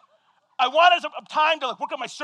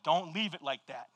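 quiet inner voice; 10 semitones lower, 13 dB quieter than usual. Retold or imitated. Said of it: "he's like".

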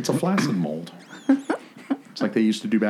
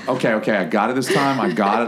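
ordinary talk

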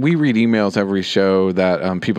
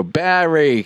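"Barry" said with Boston vowels, a bit higher; 5 semitones higher than usual. Retold or imitated. Host imitating Boston friend: Boston-accented "Barry". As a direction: tease her with a Boston accent. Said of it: "I keep waiting for you to go".

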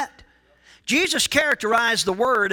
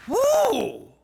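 high-pitched "woo"; excited whoop; 12 semitones higher than usual. Real, not acted. Preacher breaking off mid-verse, high excited whoop.

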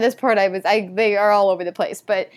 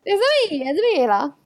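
high cartoonish Italian accent; playful mock Italian catchphrase; Super Mario; 7 semitones higher than usual. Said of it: "it's almost Super Mario-esque".

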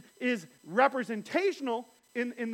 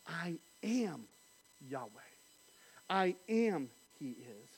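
low, soft, slow; hushed name of God; 7 semitones lower, 8 dB quieter, slower than usual. Acted. Preacher softly speaking God's name to Moses. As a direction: draw out each word.

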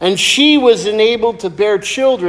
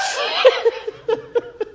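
high giggle; 6 dB quieter than usual. Real, not acted. Laughing at own story.

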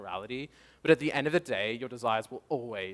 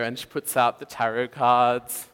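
ordinary talk, nothing marked

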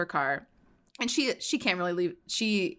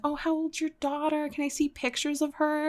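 gushing sweet talk; 9 semitones higher than usual. Retold or imitated. Retold sweet-talking the mechanic.

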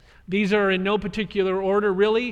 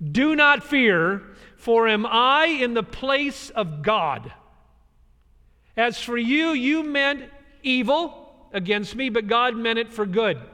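slow, raised pleading reassurance; earnest Joseph; 4 semitones higher, 4 dB louder, slower than usual. Acted. Joseph slowly reassuring his frightened brothers, earnest.

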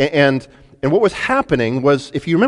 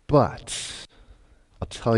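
conspiratorial whisper; 3 semitones lower, 8 dB quieter, much slower than usual. Acted. Balaam's secret deal with Balak.

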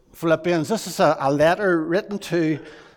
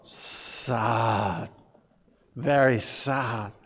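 sad; 6 semitones lower, 3 dB quieter, much slower than usual. Shown as a demonstration. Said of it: "This wee boy has hurt his knee".

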